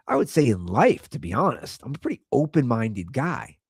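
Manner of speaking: normal talking voice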